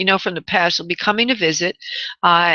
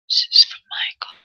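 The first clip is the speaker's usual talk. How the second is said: hushed whisper to the spirit guides; whispered aside naming the caller. Real, not acted. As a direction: breathe it out as a private whisper.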